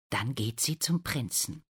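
ordinary delivery

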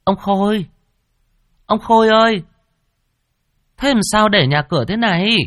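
high, loud, alarmed woman's voice calling her husband; wife calling out for her husband in a high, loud voice. Acted. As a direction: shout across the house for her husband in alarm. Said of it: "bà vội gọi".

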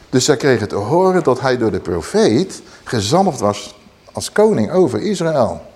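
usual speaking voice

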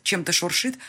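plain reading voice

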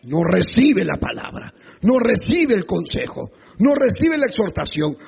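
normal talking voice

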